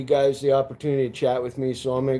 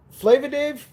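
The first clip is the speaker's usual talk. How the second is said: lilting high call of the name; playful high-pitched name; high sing-song name; 10 semitones higher than usual. Pretend. Sings name to chat.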